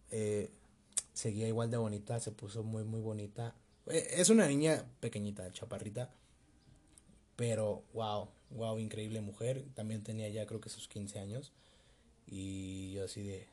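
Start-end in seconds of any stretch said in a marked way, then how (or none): none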